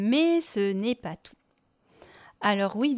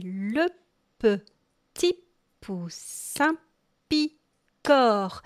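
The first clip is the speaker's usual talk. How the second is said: petit, poussin, picore spaced out with pauses, each word set apart; syllables spaced out; much slower than usual. Shown as a demonstration. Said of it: "il correspond aux syllabes".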